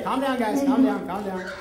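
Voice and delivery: ordinary delivery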